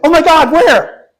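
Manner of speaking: high, loud, slow gasping alarm; alarmed shriek. Retold or imitated. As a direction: shriek it like terrible news. Cashier's alarmed reply.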